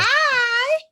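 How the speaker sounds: very high cutesy hi; squeaky pet greeting. Real, not acted.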